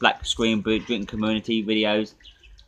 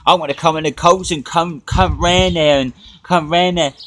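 mocking quote; 6 semitones higher, 8 dB louder than usual. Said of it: "I just dismissed Lisa L there and said".

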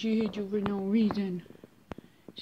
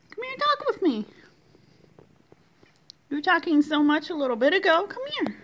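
very high, sing-song cooing; 6 semitones higher, 4 dB louder, faster than usual. Real, not acted. Coaxing the cat to come over in squeaky baby talk.

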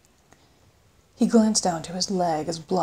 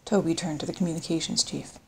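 usual speaking voice

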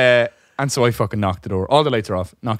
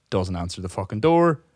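ordinary delivery

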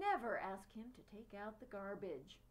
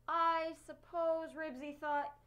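a higher, louder mocking taunt; boy jeering at his friend about his dog; 3 semitones higher, 6 dB louder than usual. A narrator's reading. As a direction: jeer at him, enjoy the dig. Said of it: "jeered Scooter".

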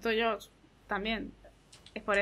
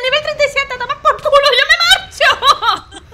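much higher and louder laughing shouts; laughing panic; 10 semitones higher, 10 dB louder than usual. Real, not acted. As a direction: shriek with laughter and flee. Shocked laughing retreat.

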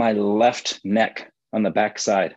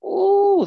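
high delighted ooh; 3 dB louder than usual. Real, not acted. Enjoying the head twist.

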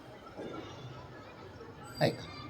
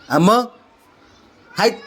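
usual speaking voice

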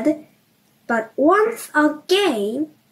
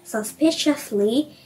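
plain reading voice

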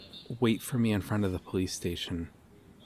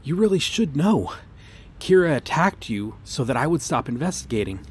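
higher, louder exasperated scolding; upset outburst; 4 semitones higher, 6 dB louder than usual. Acted. Officer scolding him about the Kira attack, upset. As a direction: snap at him for not getting it.

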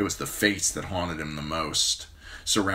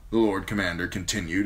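ordinary delivery, nothing marked

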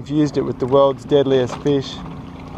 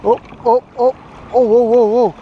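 loud, high oh-oh-whoa while fighting the fish; tense excitement; 7 semitones higher, 4 dB louder than usual. Real, not acted. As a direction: hang on to a fish that is pulling hard.

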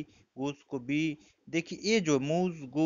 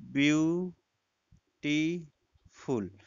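very slow BEAU-TI-FUL, split into three; syllable by syllable; much slower than usual. Shown as a demonstration. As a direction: say beautiful in three drawn-out, separate syllables. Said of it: "इसमें तीन syllable आ गया". (Beautiful को तीन हिस्सों में तोड़कर, बहुत धीरे)

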